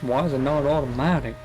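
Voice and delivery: put-on American voice, half-hearted; mock a puzzled American; lightly done American accent; bad American accent. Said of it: "that was a really bad accent".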